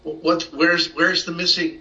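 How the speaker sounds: ordinary talk, nothing marked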